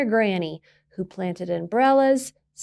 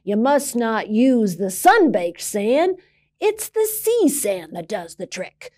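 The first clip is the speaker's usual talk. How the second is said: put-on gruff old sailor; 5 dB louder than usual. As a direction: scold like a crusty old sailor. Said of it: "marching out".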